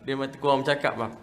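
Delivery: usual speaking voice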